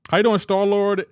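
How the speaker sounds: loud, high hello to a viewer; loud greeting. Real, not acted. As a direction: call out his name with energy.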